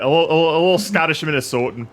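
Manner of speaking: put-on Scottish Minnesotan accent. Joking with friends in a Scottish Minnesotan accent. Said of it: "Was that your Minnesotan accent".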